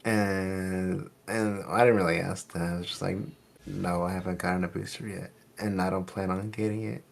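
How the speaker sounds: ordinary talk, nothing marked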